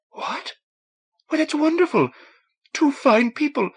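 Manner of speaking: high, happy astonishment at the news; surprised. A narrator's reading. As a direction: burst out in glad surprise.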